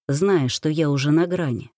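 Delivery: ordinary delivery, nothing marked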